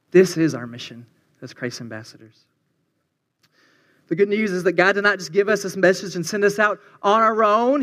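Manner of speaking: ordinary talk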